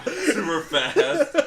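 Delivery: rattled off high and loud, laughing; super fast. Shown as a demonstration. Showing friends how he always talks, a fast laughing burst. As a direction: blurt it in a rush. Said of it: "Even I talk like that".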